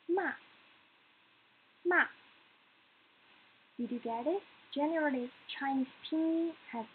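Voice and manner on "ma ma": "Ma" dropping straight down; falling tone. Shown as a demonstration. Teacher demonstrates the fourth tone, "Ma" falling straight down. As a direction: drop the pitch sharply through "Ma". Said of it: "Let's do it again".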